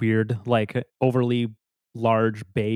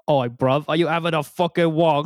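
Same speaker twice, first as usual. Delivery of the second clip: higher, cocky London geezer jab; mock London accent; 4 semitones higher than usual. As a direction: sneer it like a London lad picking a fight. Mocking wonks in British slang.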